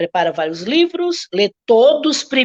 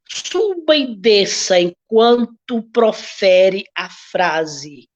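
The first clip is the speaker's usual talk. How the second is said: ordinary talk, nothing marked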